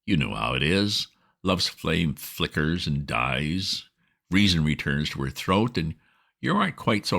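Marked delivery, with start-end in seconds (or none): none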